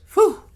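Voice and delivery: high, loud puff of relief; relieved phew. Retold or imitated. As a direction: imitate a sharp, exaggerated phew. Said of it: "and he goes".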